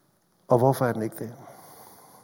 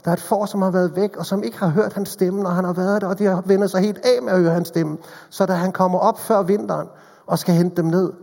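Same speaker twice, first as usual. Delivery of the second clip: louder, higher preaching voice, urgent; fervent preaching; 4 semitones higher, 5 dB louder than usual. Acted. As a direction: preach it to the whole congregation. Preaching at full force. (inderlig prædiken med fuld kraft)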